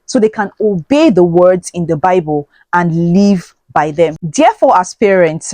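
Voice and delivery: ordinary talk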